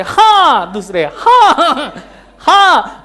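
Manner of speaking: high, loud yes echoed from angles; mock the soap opera repeated yes; TV serial dramatic yes. Teacher mocking soap serial heroine's yes from every angle. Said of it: "एक angle से".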